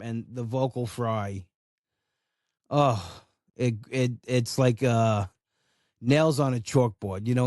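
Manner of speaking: ordinary delivery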